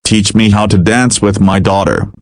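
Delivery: usual speaking voice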